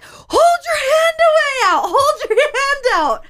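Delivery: high, loud, half-laughing yell; laughing shout. Retold or imitated. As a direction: yell the order while cracking up. Mother shouting, retold, laughing.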